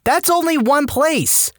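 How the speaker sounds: high-pitched, incredulous ranting; exasperated rant. Acted. Narrator breaks off to rant at Redditors about et cetera, exasperated rant. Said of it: "I am ranting about this".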